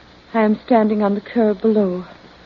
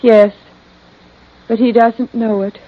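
halting hypnotized answers; in a trance; 12 semitones higher, 4 dB louder than usual. Acted. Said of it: "she's in a trance".

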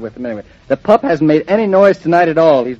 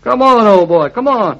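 urgent coaxing; 7 semitones higher, 4 dB louder than usual. Acted. Coaxing stubborn dog down steps.

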